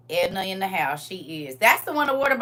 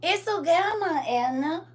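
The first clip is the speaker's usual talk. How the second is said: put-on baby-greeting voice; 9 semitones higher, slower than usual.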